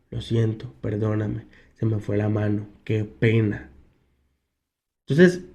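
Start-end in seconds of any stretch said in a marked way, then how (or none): 0.1-3.7 softly, head bowed, a meek mock apology; lowering yourself to say sorry, lightly; humble apology. Admitting fault to family, softer and sheepish. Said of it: "agachar la cabeza y decir".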